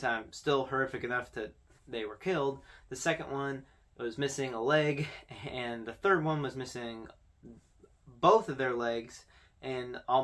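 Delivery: ordinary delivery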